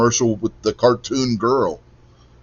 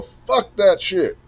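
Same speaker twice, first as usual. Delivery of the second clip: slow, higher mock Marine yell; Marine Corps voice; 11 semitones higher, 3 dB louder, much slower than usual. Host reading out a viewer's comment in a mock Marine Corps yell. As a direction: yell it like a Marine, playfully drawn out. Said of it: "In my best Marine Corps voice, I'll yell".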